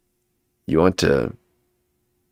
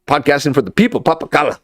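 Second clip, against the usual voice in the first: announcer catchphrase; 5 semitones higher, much faster than usual. Acted. Host's show catchphrase.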